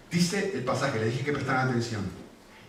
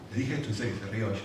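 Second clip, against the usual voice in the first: ordinary delivery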